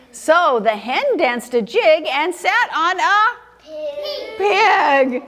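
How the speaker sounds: slow, lilting picture-book narration; story reading to children. A narrator's reading. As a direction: stretch it out and hold back the last word. Reads picture book aloud.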